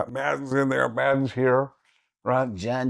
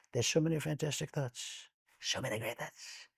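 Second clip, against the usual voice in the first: much softer, lightly voiced Trump; a hint of boastful Trump; Donald Trump impression; 13 dB quieter than usual. Shown as a demonstration. Showing the hosts Trump with the thinking eyes. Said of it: "if I add the eyes".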